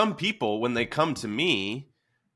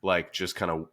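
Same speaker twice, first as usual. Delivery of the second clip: usual speaking voice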